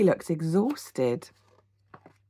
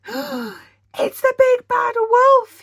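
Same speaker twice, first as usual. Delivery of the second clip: much higher, louder, very slow; terrified cry; 11 dB louder, much slower than usual. A narrator's reading. Percy sees the wolf, crying out in terror. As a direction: wail it out in terror. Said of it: "he cried".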